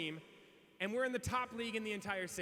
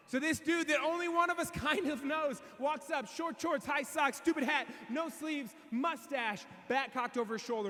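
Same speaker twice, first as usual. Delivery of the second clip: higher, shouted, slow listing; excited shout; 7 semitones higher, slower than usual. Retold or imitated. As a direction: build him up like a hero entrance. Hyped description of arrival.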